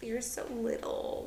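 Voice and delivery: soft cooing creepy-guy imitation; mocking a man calling her little. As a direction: coo it like a leering man.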